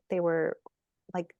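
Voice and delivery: ordinary talk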